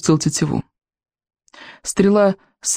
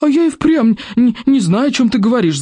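yelling; 8 semitones higher than usual. A narrator's reading. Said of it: "заорала она".

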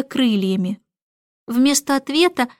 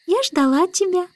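put-on higher, childlike little voice; baby girl voice; 8 semitones higher than usual. A narrator's reading. Baby tells the starling of waiting, high childlike voice. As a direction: say it as a tiny girl.

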